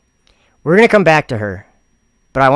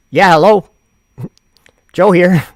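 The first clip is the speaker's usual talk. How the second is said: louder, higher answering voice; phone call voice; 5 semitones higher, 7 dB louder than usual. Pretend.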